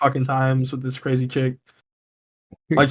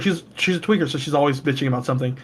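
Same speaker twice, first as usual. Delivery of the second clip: ordinary talk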